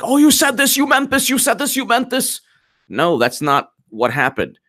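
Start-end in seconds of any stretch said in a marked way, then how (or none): 0.0-2.4 high, whiny mocking voice, much higher; mocking the left. Host mocking leftist critics twisting Trump's words, in a shrill high mock voice. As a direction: parody accusers in a squeaky sing-song. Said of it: "the left was like".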